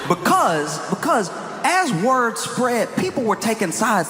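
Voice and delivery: ordinary talk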